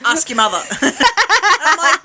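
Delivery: punchline said through a laugh. Real, not acted. Mocking her partner's lazy answer to the kid, laughing. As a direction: tease him while laughing.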